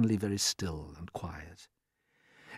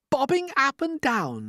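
crying out; slower than usual. A narrator's reading. Everyone echoing the ladybird, high incredulous cry. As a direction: throw it back in disbelief, falling at the end. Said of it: "they cried".

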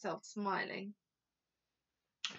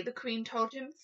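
ordinary delivery

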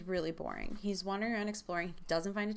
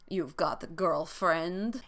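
slow, put-on cartoon Russian accent; bad Boris and Natasha accent; 4 dB louder, slower than usual. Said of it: "like, Boris and Natasha accent".

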